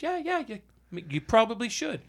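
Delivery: high, soft, mock-obliging retort; sarcastic agreement. Buyer answering supplier about changing the website, sarcastic agreement.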